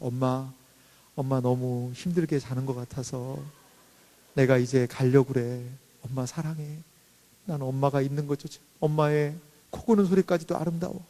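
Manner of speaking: low, soft, gentle daughter; tender letter voice. Acted. Thoughtful daughter writing to her sleeping mom, tender and hushed. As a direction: voice a loving note softly, careful not to wake her.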